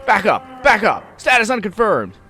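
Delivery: high, loud frantic police orders; screamed into megaphone. A narrator's reading. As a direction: scream it like a panicking cop. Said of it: "raises again and screams".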